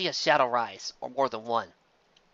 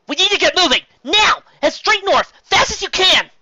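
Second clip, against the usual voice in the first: much higher, much louder urgent command; rally the group to run now; urgent shout; 10 dB louder than usual. Acted. Twilight urges flight.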